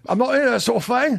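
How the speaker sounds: high-pitched comic Cockney; hammed-up Cockney catchphrase; Cockney accent. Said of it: "it's just Riggle saying".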